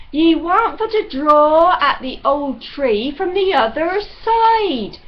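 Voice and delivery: loud, resonant servant giving unwelcome advice; pipe-organ voice. A narrator's reading. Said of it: "in a voice like a pipe organ".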